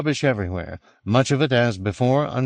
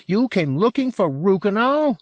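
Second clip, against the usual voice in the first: high-pitched, astonished question; disbelieving Englishman's squawk of a question; utter incredulity; 12 semitones higher than usual. A narrator's reading. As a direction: ask it high, as if he were mad. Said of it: "as though this were tantamount to insanity".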